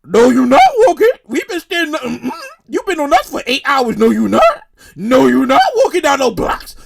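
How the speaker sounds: mock refusal from aching feet; talking sore feet. Tired feet refusing rider's walk, mock sassy refusal. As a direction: grunt and refuse as if you were tired feet. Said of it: "My dog's like".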